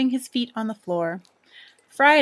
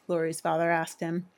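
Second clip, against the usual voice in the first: plain reading voice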